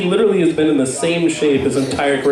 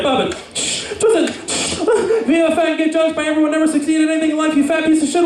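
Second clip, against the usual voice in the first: high, fast toy commands; Bop It toy voice speeding up; 11 semitones higher than usual. Pretend. Life as the Bop It toy, getting faster, high rapid commands. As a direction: rattle the orders off high and fast.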